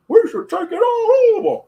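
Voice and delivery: over-the-top Bane voice, loud and slow; Bane impression. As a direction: ham up a masked villain ranting. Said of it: "I'm not Bane off of Batman, The Dark Knight Rises. Be like".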